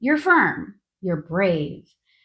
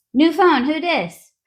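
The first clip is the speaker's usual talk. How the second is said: sassy mock answer; 7 semitones higher, 5 dB louder than usual. Mock answering the hospital call.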